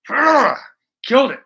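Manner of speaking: ordinary talk, nothing marked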